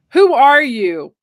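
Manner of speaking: loud, slow, high demand to the figure; alarmed demand. Retold or imitated. Woman to faceless cowboy ghost over bed, loud slow demand. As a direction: challenge the intruder loudly and slowly.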